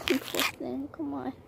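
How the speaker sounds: soft, high pitying voice. Real, not acted. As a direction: coo over a stuck ant. Pitying trapped ant.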